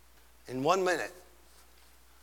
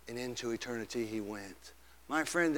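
ordinary talk

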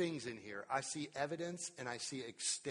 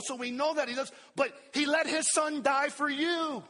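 higher and louder, driving sermon; impassioned preaching; 9 semitones higher, 9 dB louder than usual. Real, not acted. Preacher pressing the cross on the congregation, impassioned. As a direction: pound it home with conviction.

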